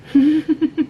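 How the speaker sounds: a real giggle at oneself; bashful laugh. Real, not acted. Admitting a guilty pleasure to viewers, giggle.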